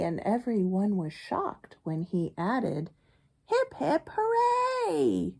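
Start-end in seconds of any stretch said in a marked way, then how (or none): 3.5-5.3 high cheer of hip hip hooray; hammed-up cheer. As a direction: cheer like a party crowd. Duck cheers.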